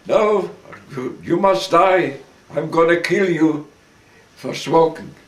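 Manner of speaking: angry threat. Retold or imitated. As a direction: keep hammering the sentence home. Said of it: "commandant repeats death threat".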